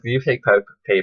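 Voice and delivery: ordinary talk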